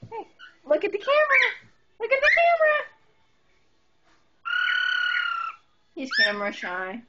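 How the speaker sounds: put-on high sing-song baby voice to a parrot; pet baby talk. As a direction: coax the bird like talking to a toddler. Coaxing a parrot toward camera.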